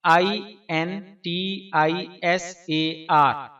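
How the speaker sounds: slow letter-by-letter spelling. Shown as a demonstration. Giving viewers the English spelling of the name, slowly spelled out. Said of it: "Angrezi spelling".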